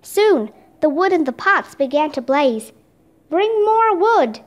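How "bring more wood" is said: high, stretched-out order; storyteller's moderate, drawn-out shout; narrated shout. A narrator's reading. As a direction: call it slowly across the room. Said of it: "Leonardo shouted".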